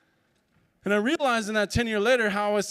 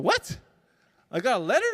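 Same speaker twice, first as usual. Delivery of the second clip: high shocked squeak on what; 4 semitones higher, 3 dB louder than usual. Retold or imitated. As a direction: caught completely off guard, pitch jumps up. Stunned on hearing about letter.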